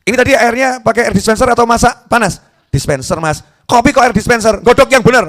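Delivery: angry husband. Retold or imitated. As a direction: bark orders about the coffee. Suami memarahi istri. (suami memarahi istri soal air kopi, keras)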